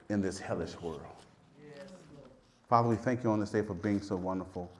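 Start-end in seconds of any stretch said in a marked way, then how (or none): 2.7-4.7 low, soft, even prayer voice; closing prayer. Real, not acted. Preacher thanking the Father at the close of service, low soft prayer. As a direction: drop into a hushed, reverent prayer.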